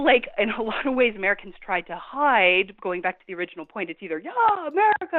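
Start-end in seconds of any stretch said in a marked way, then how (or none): 4.2-5.2 high-pitched imitation of a rah-rah patriot; hammed-up national pride; mock patriotic cheer. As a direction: whoop it up like a blinkered flag-waver. Mimics blinkered patriotic Americans.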